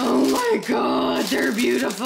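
excited gasp. Real, not acted. Seeing the new boots.

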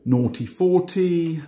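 slow bingo call; bingo caller. Acted. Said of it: "I start doing my bingo calls".

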